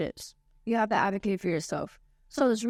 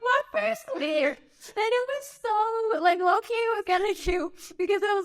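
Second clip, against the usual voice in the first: laughing while talking; 10 semitones higher than usual. Real, not acted. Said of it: "Even though I'm cackling right now".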